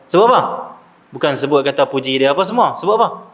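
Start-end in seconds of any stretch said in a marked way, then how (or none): 0.1-0.4 imitating kids asking back, high and loud; raised, loud mock-puzzled question; puzzled children's question. Children question the retold request. 2.7-3.3 imitating kids asking back, pitched up; higher mock-puzzled question; puzzled children's question. Children question the request again.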